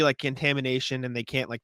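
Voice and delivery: usual speaking voice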